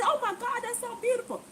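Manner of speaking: high, admiring coo; gushing lady. Retold or imitated. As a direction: pitch up, marvel at the flowers. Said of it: "She said".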